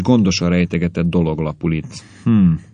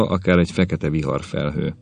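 plain reading voice

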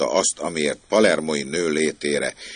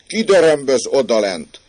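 loud, high, pert call down; pert, cocky; 10 semitones higher, 7 dB louder than usual. Acted. Servant girl to the man banging at the gate, cocky. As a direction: cockily challenge whoever is below, voice raised. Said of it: "és hegykén leszólt".

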